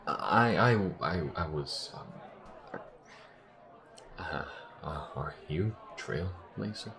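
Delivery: stunned, halting stammer; hesitant, disbelieving stutter; taken aback. Acted. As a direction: lose your words, then ask softly and slowly. Startled visitor stammers at the AI.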